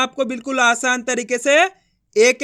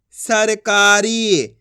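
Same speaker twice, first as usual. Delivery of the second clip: very slow, stretched "सरकारी"; sounds joined slowly; 4 dB louder, much slower than usual. Shown as a demonstration. Teacher blending the letters into a word, very slowly. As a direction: draw out every syllable of "सरकारी". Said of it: "तो मिलके बन जाएगा".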